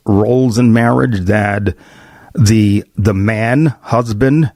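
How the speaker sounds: ordinary talk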